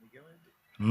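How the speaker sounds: soft reassurance fading to almost nothing; gentle words trailing away quietly; fading soft comfort. Retold or imitated. Soothing distressed person.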